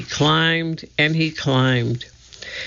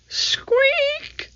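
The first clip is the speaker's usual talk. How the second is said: tiny high mouse squeak. A narrator's reading. The mouse in the treehouse, high squeak.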